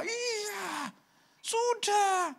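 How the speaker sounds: very high drawn-out yes; exasperated reply. Retold or imitated. Pastor answering the grieving man, high exasperated insistence. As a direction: stretch it out, high and emphatic.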